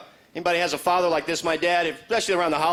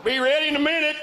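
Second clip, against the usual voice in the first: salt shaker voice; 9 semitones higher, faster than usual. Pretend.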